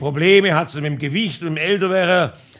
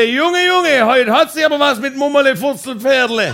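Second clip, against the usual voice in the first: mocking shout, much higher and louder; neighbour yelling; 6 semitones higher, 5 dB louder than usual. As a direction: holler it teasingly across. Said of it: "Der Nachbar schrie".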